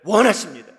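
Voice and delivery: "원하십니다"를 훨씬 크게 외치며 힘주어 끝맺기; 설교의 절정처럼 "원하십니다"를 크게 외쳐라. Acted. ("원하십니다"를 크게 외치며 단호하게 끝맺기)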